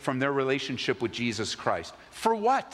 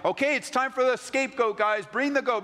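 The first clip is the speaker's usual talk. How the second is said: higher, ringing call to the congregation; 11 semitones higher than usual. Pretend. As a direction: summon the goat like a showman. Pastor calls for the goat.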